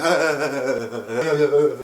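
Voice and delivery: juddering vocal rattle of a bumpy ride; bumpy juddering noise. Retold or imitated. Skateboarder rolling down the wavy metal tube, juddering noise. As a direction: voice shaken by bumps. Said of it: "it was really bumpy".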